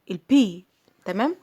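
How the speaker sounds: ordinary talk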